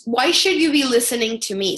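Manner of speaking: normal talking voice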